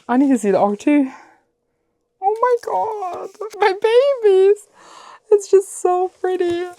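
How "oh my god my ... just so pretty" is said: high, slow cooing over the books; cooing as if to her babies; emotional gush. Real, not acted. To viewers about her beloved books, a high tender coo. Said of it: "I'm emotional".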